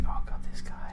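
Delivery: breathy mocking whisper of a first impression; whispered aside. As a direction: hush it as if thinking it under the breath. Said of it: "at first I was like".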